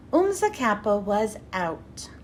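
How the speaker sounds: ordinary delivery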